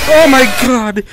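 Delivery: high, loud startled yell; real fright, yell it out; shocked scream. Real, not acted. Horror game jump scare reaction.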